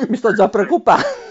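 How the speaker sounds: high laugh into the words. Real, not acted. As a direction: laugh while you speak. Painters in studio, laughing.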